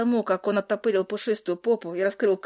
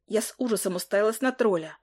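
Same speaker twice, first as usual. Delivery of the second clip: plain reading voice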